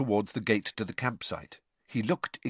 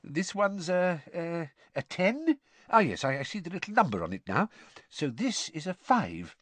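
fumbling, unsure; 8 semitones higher than usual. Acted. Mr Weasley squinting at Muggle notes, hesitant and halting. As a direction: stumble through like the money is alien.

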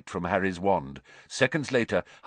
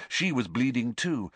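plain reading voice

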